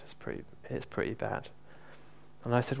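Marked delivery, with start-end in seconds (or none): 0.1-1.5 breathy near-whisper of a sad woman; lady quietly admitting her back pain is bad; sad, almost whispered. Sad hushed near-whisper. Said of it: "she looked really sad at that moment".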